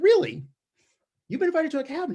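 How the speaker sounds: sharp high disbelieving "Really?"; can't believe what she just said; mock shock at the cabin invite; incredulous surprise. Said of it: "I said".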